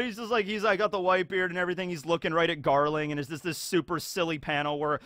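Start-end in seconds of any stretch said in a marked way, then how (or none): none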